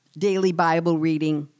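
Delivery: usual speaking voice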